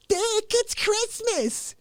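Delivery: high, slow pleading; pleading mother. Retold or imitated. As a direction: plead with a whine. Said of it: "She's like".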